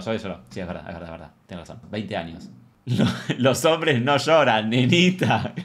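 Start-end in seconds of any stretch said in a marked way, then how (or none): 2.8-5.5 slow, drawn-out mock scolding; hammed-up macho boomer taunt; old boomer. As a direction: play an old boomer telling off a crybaby. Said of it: "haciendo esos comentarios de viejo boomer".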